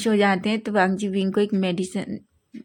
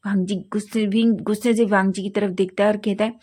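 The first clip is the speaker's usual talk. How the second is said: usual speaking voice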